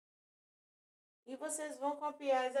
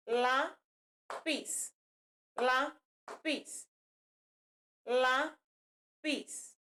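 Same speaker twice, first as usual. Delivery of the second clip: LÁ, pause, PIS; split into syllables; much slower than usual. Shown as a demonstration. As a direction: break "lápis" into two separated syllables. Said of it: "eu tenho dois fonemas". ("lápis" separado em duas sílabas, com pausa)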